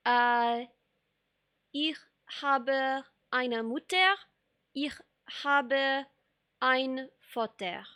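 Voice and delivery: slow, deliberate German sentences; in schoolbook German, word by word; careful German. Shown as a demonstration. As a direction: recite your few German phrases slowly, like a beginner. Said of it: "I got, like".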